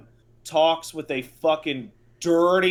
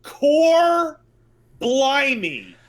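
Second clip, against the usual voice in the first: "Core blimey!" in a hammed-up Cockney accent, much higher and louder, "Core" squealed; imitating a Cockney game dub, "Core" pitched way up; mock Cockney exclamation; 9 semitones higher, 6 dB louder, much slower than usual. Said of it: "side quest starts with".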